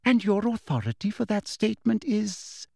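a lightly voiced sugary bureaucrat; prim official. A narrator's reading. Umbridge questions McGonagall, prim and cold.

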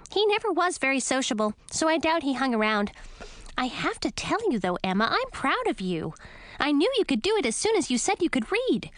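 high, lively little bat. Acted. Lil the bat speaks.

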